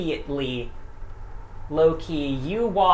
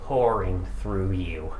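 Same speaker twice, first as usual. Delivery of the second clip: somber narration; 3 semitones lower than usual. Acted. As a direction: wind the story down gravely. GM closes the session.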